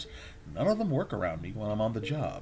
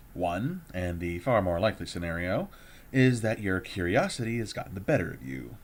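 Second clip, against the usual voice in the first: normal talking voice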